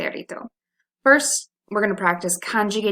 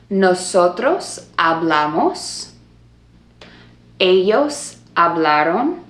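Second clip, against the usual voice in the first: slow drill for repeating; slower than usual. Shown as a demonstration. Teacher drilling students to repeat, slow and clear. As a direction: say each form slowly for the class to echo. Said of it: "repeat and gesture after me".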